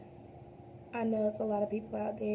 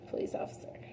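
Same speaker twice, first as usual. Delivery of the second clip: unvoiced hush on the last words; whispered aside; 6 dB quieter, faster than usual. Real, not acted. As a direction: drop to a whisper to soften it. Swear word softened by whispering.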